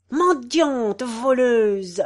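shouted insult. A narrator's reading. The cat screaming at the poor woman, furious shout. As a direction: hurl the insults at her. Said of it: "cria-t-il".

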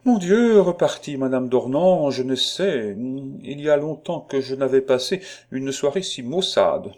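high, loud lament over a tedious evening; exasperated society lady. Acted. Lady complains of a dismal evening.

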